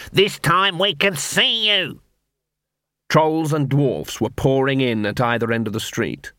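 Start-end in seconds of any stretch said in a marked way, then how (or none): 0.1-2.0 high, drawn-out taunting call; shouted. A narrator's reading. Dwarf taunts from back of crowd, slow high shout. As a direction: call it out slowly, pitched up. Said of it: "shouted a dwarf".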